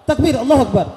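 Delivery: takbir keras dan tinggi; seruan takbir. Real, not acted. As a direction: serukan takbir sekuat tenaga. Memimpin takbir jamaah. (penceramah mengajak jamaah bertakbir, berseru keras)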